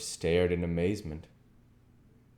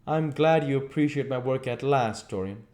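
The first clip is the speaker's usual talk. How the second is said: coldly; 8 semitones higher, 5 dB louder than usual. A narrator's reading.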